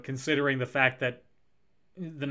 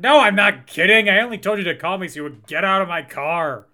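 loud, raised, fed-up outburst; harsh rebuff; 3 semitones higher, 8 dB louder than usual. Acted. Jerry throwing out an intruder, loud and harsh. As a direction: fire back emphatically and order them out. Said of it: "it's a little harsh".

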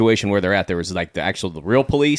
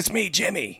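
high, softer character voice; mock stage-whisper reveal; 9 semitones higher, 5 dB quieter, slower than usual. Jimmy leaning forward to reveal himself, high hushed voice. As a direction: announce yourself high and softly. Acted reveal by disguised friend.

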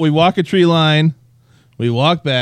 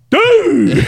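much higher, loud yell; shout; 5 dB louder than usual. Retold or imitated. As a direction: yell it startled. Retold startled shout after gunshot.

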